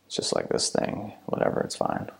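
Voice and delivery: trailing off into a breathy half-whisper; muttered brush-off, dropping to a whisper; dismissive mumble. Retold or imitated. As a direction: shrug it off, fading to nothing. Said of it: "you're like".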